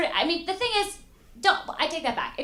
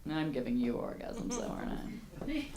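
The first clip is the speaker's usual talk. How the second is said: low, soft aside to his date; acted boyfriend murmuring for reassurance; confiding boyfriend; 6 semitones lower, 9 dB quieter than usual. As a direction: lean in and check quietly, hushed. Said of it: "the guy kinda leans over like".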